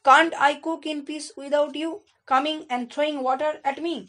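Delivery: a hint of a grumpy sailor complaining; sailor doll. A narrator's reading. Sailor doll scolds Taffy for soaking his porridge, mildly cross. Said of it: "seller ডলটা কি বলছে".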